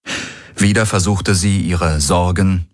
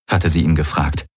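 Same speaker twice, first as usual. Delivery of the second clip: usual speaking voice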